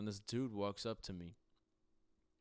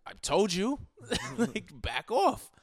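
high, loud exasperated telling-off with a laugh; raised-voice mock confrontation, drawn out at the end; retold loud warning; 11 semitones higher, 11 dB louder, slower than usual. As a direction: act out warning someone off, half laughing. Retells telling drunk man off.

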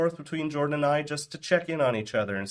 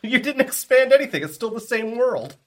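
laughing; 12 semitones higher, 8 dB louder than usual. Real, not acted. Laughing retort.

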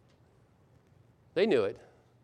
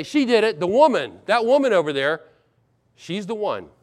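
higher, louder finger-pointing; accusing, distancing; 4 semitones higher, 4 dB louder than usual. Acted. Adam pushing his wife away as culprit, accusing. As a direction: pin it on someone else, raised voice. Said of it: "putting her at arm's length".

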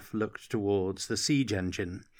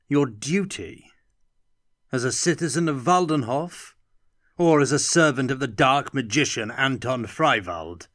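raised and louder, accusing; angry interrogation; 4 semitones higher, 5 dB louder than usual. Acted. The Graf accuses Katerina of serving a dark magician, angrily. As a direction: hurl each question at her.

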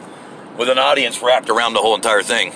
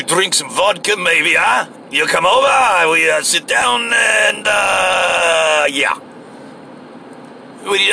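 slow, playful mock Russian accent, pitched up; fake Russian accent; 4 semitones higher, slower than usual. Inviting a comrade over for vodka, in a fake Russian accent. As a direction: put on a hammy Russian accent, stretching the words. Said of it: "fake Russian accent".

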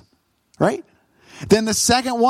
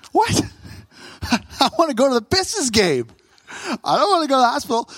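high startled what, then fast protest; incredulous whine; 4 semitones higher, faster than usual. Retold or imitated. Husband protesting to dad about skipping the game, incredulous. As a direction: whine like a kid losing his treat.

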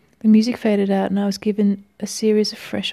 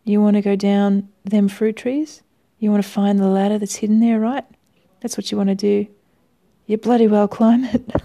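put-on hearty, louder stage Australian accent; 4 dB louder than usual. Hearty phone voice urging the caller into his garden, in stage Australian. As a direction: boom it like a jolly Australian resort manager. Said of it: "it was a kind of stage Australian".

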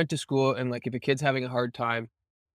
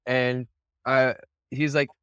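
ordinary talk, nothing marked

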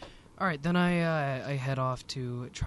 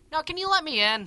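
calling through door; 14 semitones higher, 9 dB louder than usual. Acted. Knocking, talking through the door.

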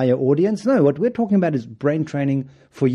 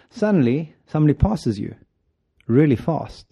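ordinary talk

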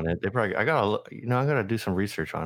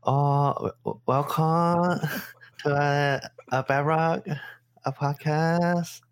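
put-on high, slow sing-song Korean accent; mock Korean accent; 6 semitones higher, much slower than usual. Co-host egged on to welcome listeners in a mock Korean accent. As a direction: draw it out in a singing Korean accent. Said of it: "Can you do your Korean accent?".

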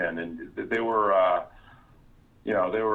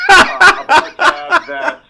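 slow, high, laughing through the words; can't hold the laugh in; 16 dB louder, slower than usual. Real, not acted. Cracking up mid sentence.

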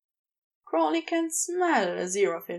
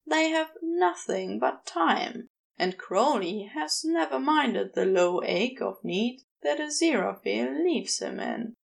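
usual speaking voice

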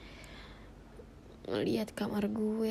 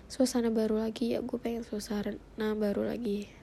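ordinary talk

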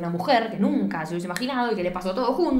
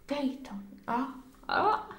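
soft, higher, weak moaning; feigned faintness; 5 semitones higher, 8 dB quieter, much slower than usual. Retold or imitated. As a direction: play someone faint, calling out softly. Acts out fainted woman being found.

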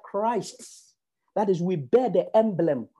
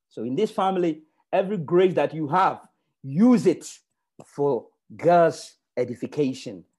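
ordinary talk, nothing marked

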